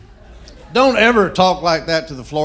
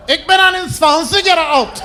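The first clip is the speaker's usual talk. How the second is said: mock German; 14 semitones higher, 7 dB louder than usual. Comedian showing off his one German phrase, hammed-up German accent. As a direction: bark it out in fake German. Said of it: "sits down and goes".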